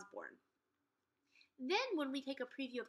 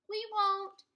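high, loud quick reply; calling back; 10 semitones higher, 7 dB louder than usual. Acted. Jack answering his mom, high loud call. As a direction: toss it back over the shoulder.

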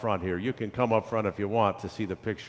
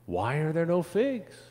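lightly voiced child's question, pitched higher; children asking; 8 semitones higher than usual. A narrator's reading. Children question the lack of figs, higher questioning voice. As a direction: lift it like a puzzled child. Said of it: "the children asked".

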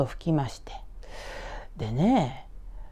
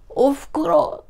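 tearful son; 10 semitones higher, 5 dB louder, slower than usual. Acted. Son calls to his mother, weeping.

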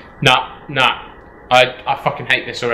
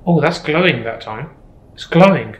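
ordinary talk

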